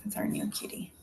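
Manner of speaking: hushed aside. Real, not acted. Aside about the cat mid-class.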